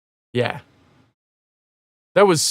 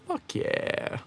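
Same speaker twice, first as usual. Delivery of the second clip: whispered, breath-only approval; hushed cheer; 12 dB quieter than usual. Real, not acted. Streamer reacting to viewer's job test, whispered approval. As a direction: whisper it like a private celebration. Hushed reply to a chat message.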